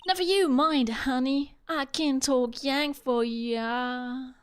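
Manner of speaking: Southern drawl, slow on the last line; Southern accent. Acted. Comforts upset Maya in a Southern accent. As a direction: play a folksy Southern auntie. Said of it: "I love doing accents".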